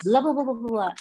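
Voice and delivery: mimicking non-stop gabble with no pauses; rushed babble. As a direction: run it all together like a motormouth. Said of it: "speak and take pause before I was like".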